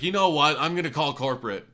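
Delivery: loud, high imitated outburst; angry threat. Furious customer threatening the mechanic with corporate, imitated shout. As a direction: mimic a customer shouting an ultimatum.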